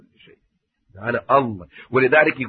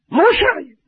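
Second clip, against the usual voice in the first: rhetorical outcry; 13 semitones higher, 4 dB louder than usual. Acted.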